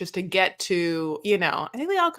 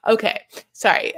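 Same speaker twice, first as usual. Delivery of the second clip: usual speaking voice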